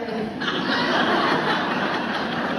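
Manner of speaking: loud embarrassed laugh at herself; burst of laughter. Real, not acted. Laughs at her own slip.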